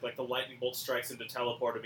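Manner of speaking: normal talking voice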